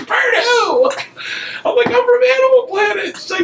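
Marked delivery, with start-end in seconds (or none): 0.1-0.9 very high, shouted imitation of the woman; high-pitched retold challenge; mock woman shouting. Woman shouts through closed door, retold. 1.9-3.2 high, strained mock shout; retold high-pitched holler; calling through door.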